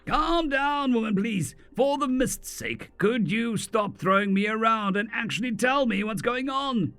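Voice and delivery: yelling back. Acted. Martin yelling at the mage throwing him around, exasperated. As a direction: push back at her, fed up. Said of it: "Martin yelled back at her".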